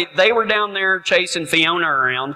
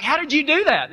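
put-on kids asking; 9 semitones higher, 3 dB quieter than usual. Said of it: "they're like".

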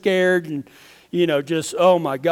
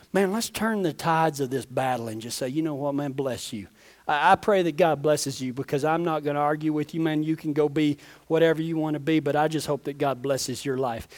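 softer, lower prayer voice; preacher praying gently over the church; closing prayer; 5 semitones lower, 5 dB quieter than usual. Acted. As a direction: pray quietly over the congregation.

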